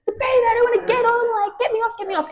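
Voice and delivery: high, panicky imitation of a stranger, a touch mocking; imitating distraught lady. Friend retelling a distraught lady on the crowded train, high and panicky. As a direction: squeak it out like a flustered woman on a packed train. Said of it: "This lady, quite distraught".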